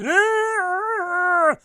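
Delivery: long, very high screech; sustained mimicry of a screaming kid; imitated kid's scream. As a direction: screech like a child running wild.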